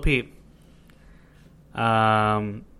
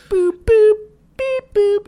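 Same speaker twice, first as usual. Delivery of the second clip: high squeaky beeping dial tones; phone dial beeps; 7 dB louder, slower than usual. Retold or imitated. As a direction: chirp the beeps like a keypad. Miming dialing a fake phone.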